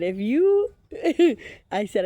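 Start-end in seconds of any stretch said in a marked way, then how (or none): 0.0-1.4 words breaking into a louder laugh; laughing as she retells it; laughing through words. Real, not acted. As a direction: crack up mid-sentence.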